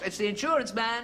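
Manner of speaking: high, acted call; shouting through letterbox. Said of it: "he shouts through the letterbox".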